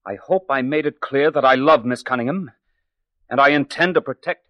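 loud, indignant scolding; angry rebuke. Acted. Edgar to the eavesdropping secretary, loud and angry. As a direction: tell the snoop off and defend her.

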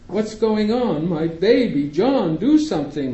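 mother pleading, voice rising in alarm; shrill panicked mother. A narrator's reading. Mother begs John to help her baby, panicking. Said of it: "My mother's shrill voice".

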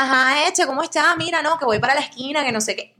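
put-on Barranquilla coastal Colombian accent, bright and lilting; acento costeño. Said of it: "ese acento a mí me sale perfecto". (acento costeño de Barranquilla)

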